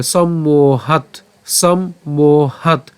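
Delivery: সম্মোহাৎ drawn out as sam, mo, hat; slow teacherly sounding-out of সম্মোহাৎ; syllable demo. Shown as a demonstration. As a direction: hold the o of সম্মোহাৎ, stop on the t. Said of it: "এরপর একই রকমভাবে".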